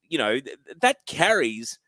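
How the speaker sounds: ordinary talk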